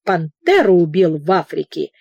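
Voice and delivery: usual speaking voice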